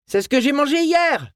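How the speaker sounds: voix de garçon aiguë lancée à pleins poumons; répondre à sa mère en criant de loin; à tue-tête. A narrator's reading. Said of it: "répondit Tyler à tue-tête". (garçon qui répond à tue-tête)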